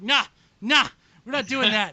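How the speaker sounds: loud, high mock brush-off; a girlfriend refusing, loud and high; dismissive girlfriend. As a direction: imitate her shutting it down loudly. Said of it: "and she's like".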